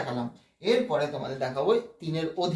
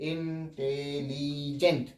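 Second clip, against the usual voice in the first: Intelligent very slow, lower and softer; light pauses between syllables; 4 semitones lower, 6 dB quieter, much slower than usual. Shown as a demonstration. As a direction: draw out intelligent with slight breaks after each syllable. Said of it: "হালকা pause নেই". (Intelligent খুব ধীরে, হালকা pause দিয়ে)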